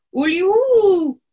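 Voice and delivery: long, high, loud shriek, slow; wild shriek. Acted. Woman shrieking at hawks like a wild beast. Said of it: "she shrieks like a wild beast".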